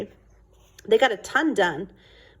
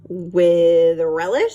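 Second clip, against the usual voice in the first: slow, louder, savoring the pun; dry dad joke; 7 dB louder, much slower than usual. Acted. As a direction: draw out the punchline. Father's deadpan joke.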